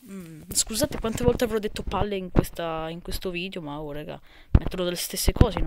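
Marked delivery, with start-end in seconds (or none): none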